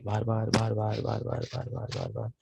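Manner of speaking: fading to a breathy whisper; fading dreamy repeat. Acted. Jaimini haunted by the girl's memory, fading to whisper.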